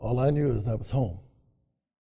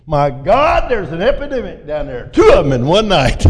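loud, higher, laughing exclamation; hearty amused shout; 4 semitones higher, 10 dB louder than usual. Retold or imitated. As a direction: boom it out while cracking up. Hess roaring with laughter.

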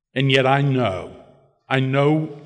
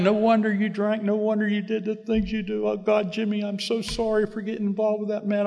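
imitating mother crying; 9 semitones higher than usual. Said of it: "And she's crying".

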